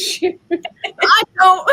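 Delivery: high, loud laugh through the words. Real, not acted. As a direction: burst out laughing mid-line. Co-host laughing in background.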